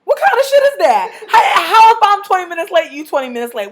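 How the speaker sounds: high, loud, laughing disbelief; laugh-shout it in outrage; incredulous outburst. Real, not acted.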